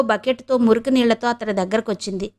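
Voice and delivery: ordinary delivery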